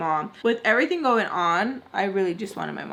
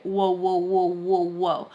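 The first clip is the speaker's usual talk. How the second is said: lower, unbothered mocking whoas; half-hearted intervention; 3 semitones lower, slower than usual. Bystander with hands in pockets, half-hearted protest. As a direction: imitate someone making no effort to step in. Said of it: "literally had his hands in his pocket".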